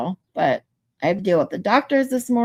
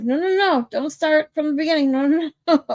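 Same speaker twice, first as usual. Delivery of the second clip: higher-pitched mock pleading from a whole courtroom; imitated courtroom protest; 7 semitones higher than usual. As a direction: beg them to stop, playing it for laughs. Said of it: "The whole courtroom was like".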